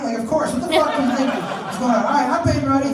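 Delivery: ordinary talk, nothing marked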